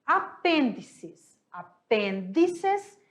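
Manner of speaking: "Apêndices" drawn out slowly, stressing the pe; slow syllable demo. Shown as a demonstration. Teacher slowly pronouncing "Apêndices" for the class. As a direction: say "Apêndices" slowly, leaning on the tonic pe. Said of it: "Qual é a sílaba tônica dela?".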